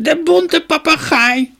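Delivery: high, loud, drawn-out crone cackle; old woman's stretched-out screech; cackling crone. A narrator's reading. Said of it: "begon te kakelen".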